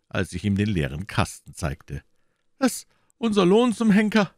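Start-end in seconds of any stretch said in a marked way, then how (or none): 2.6-4.3 höher, lauter, verdrossen geschimpft; missmutig. A narrator's reading. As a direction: als murrender Polizist, etwas lauter. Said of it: "sagte er missmutig". (Polizist ärgert sich über den leeren Kasten, missmutig)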